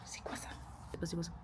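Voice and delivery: hushed whisper, barely voiced. Real, not acted. Discovering gadgets in her hotel room, whispering. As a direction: whisper it like you're sneaking around.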